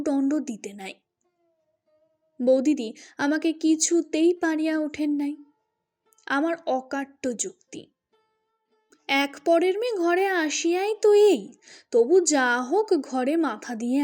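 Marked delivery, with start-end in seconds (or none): none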